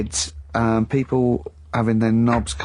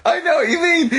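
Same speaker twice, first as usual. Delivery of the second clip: laughing. Real, not acted. To Carl about the wig, still laughing while agreeing.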